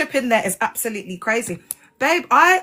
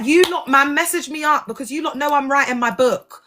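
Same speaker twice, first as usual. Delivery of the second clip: ordinary talk, nothing marked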